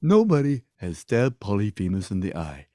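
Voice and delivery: low, dopey giant voices; neighbour giants. Acted. Giants mock Polyphemus's cries, low giant voices. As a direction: drop low and play a lumbering giant. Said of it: "all the other giants in the neighborhood go".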